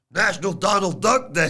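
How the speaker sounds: high, put-on impression voice; Stacks. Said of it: "read it in his voice".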